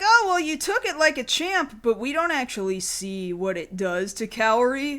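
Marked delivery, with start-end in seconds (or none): 0.0-1.8 cheery. Retold or imitated. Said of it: "And they're like".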